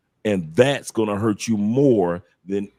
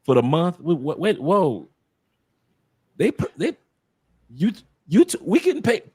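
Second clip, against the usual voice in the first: high, stammering disbelief; replayed shock, pitch climbing as it goes; retold amazement; 6 semitones higher than usual. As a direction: stumble over words in astonishment. Retells amazement at first check.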